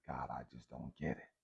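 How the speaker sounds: hushed, teary whisper; tearful whisper. Retold or imitated. Alone at the dinner table, crying to God, whispered. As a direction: let it barely come out through tears. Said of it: "the tears are running down my eyes".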